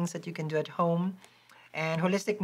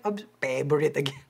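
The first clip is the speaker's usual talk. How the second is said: Filipino accent; 3 semitones lower than usual. Real, not acted. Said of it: "My Filipino accent is thick".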